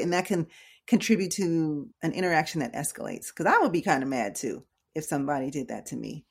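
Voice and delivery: ordinary delivery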